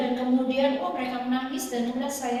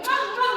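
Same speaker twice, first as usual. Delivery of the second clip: kiss sounds; 8 semitones higher than usual. Retold or imitated. Son kisses his mother.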